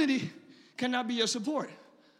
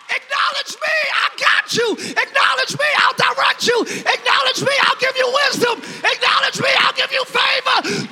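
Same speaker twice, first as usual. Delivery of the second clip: much higher, louder, commanding shout; voicing God; 10 semitones higher, 8 dB louder than usual. Preacher voicing God demanding acknowledgment, shouting. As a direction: thunder it as God calling you back. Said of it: "When God is like".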